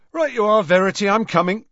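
quick, high, loud answer; prompt reply. A narrator's reading. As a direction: answer at once, calling back brightly. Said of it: "said George promptly".

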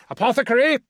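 high, loud urgent shout. Acted. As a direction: yell for help across the room. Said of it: "Jaralek shouted".

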